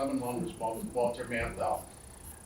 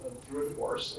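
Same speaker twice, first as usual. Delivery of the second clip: usual speaking voice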